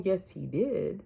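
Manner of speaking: ordinary talk, nothing marked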